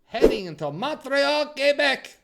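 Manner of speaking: loud, high hammed-up Québécois accent on the place names; bad French-Canadian accent. To his hockey viewers, a mock French-Canadian accent. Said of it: "that was a really bad French-Canadian accent".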